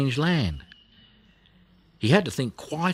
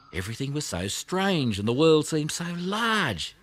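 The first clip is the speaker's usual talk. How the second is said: plain reading voice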